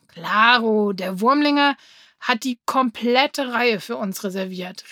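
ordinary delivery, nothing marked

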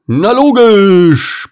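high, very loud, slow cheer. Acted. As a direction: bellow enthusiastic approval. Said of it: "bestätigte Robby".

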